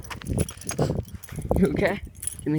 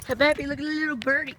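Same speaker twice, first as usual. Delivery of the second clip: pet talk; 11 semitones higher than usual. Real, not acted. Pointing out bird to dog.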